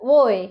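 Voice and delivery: ওয়ে said alone as the sound of W; W's sound ওয়ে, isolated; letter-sound demonstration. Shown as a demonstration. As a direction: sound out ওয়ে after a pause. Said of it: "ডব্লিউ হবে".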